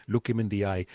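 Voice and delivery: normal talking voice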